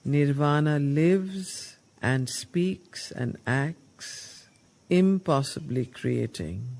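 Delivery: slow, solemn verse reading, stretching the words; poem recitation. Acted. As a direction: intone the lines like scripture.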